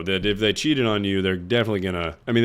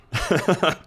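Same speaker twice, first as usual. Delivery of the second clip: high giggle escaping; stifled laugh; 8 semitones higher than usual. Real, not acted. Therapist claiming professionalism, giggle slipping out. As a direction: let a high laugh slip out.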